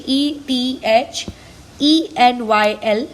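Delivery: very slow letter-by-letter spelling. Shown as a demonstration. Teacher spells the name as students write, slow dictation. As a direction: dictate each letter, drawn out.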